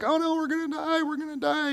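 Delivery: high, panicked squeal of a terrified man; mock-panicked high squeal; panicky squeal. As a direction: squeal it like you're sure you'll die. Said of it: "He's squealing".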